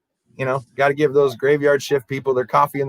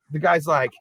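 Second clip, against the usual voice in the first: ordinary delivery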